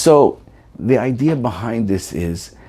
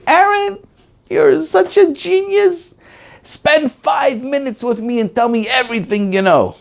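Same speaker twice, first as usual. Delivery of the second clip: great aunt voice. Retold or imitated. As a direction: coo like a fond great aunt.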